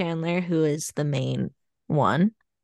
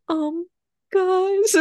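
very high-pitched cartoon candy mascot voice; orange M&M impression; 3 dB louder than usual. Co-host mimics the end of a candy commercial, high cartoon voice. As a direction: squeak it out much higher than usual. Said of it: "my impression of the orange M and M".

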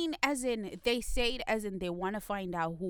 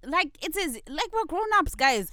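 higher and louder, fired-up rant; revved up; 4 semitones higher, 5 dB louder than usual. Real, not acted. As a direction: get worked up and push every line. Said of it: "Dean is so revved up".

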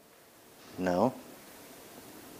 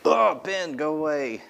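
mock annoyed dismissal; 7 semitones higher, slower than usual. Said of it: "God's not like".

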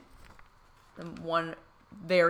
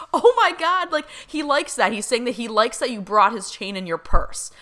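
retold excitement; 6 semitones higher, 6 dB louder than usual. Medium's excited reaction retold.

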